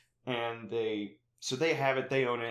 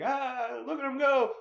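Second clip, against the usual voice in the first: gleeful Toad voice; 13 semitones higher, 5 dB louder than usual. Retold or imitated. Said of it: "he's like".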